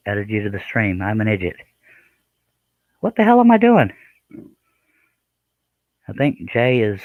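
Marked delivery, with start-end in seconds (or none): 3.0-3.9 much higher and louder, fed up with himself; real frustrated yelp at his own mistake; exasperated outburst. Real, not acted. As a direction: blurt it out at yourself in frustration. Host flustered by his own mistake.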